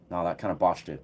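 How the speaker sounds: ordinary delivery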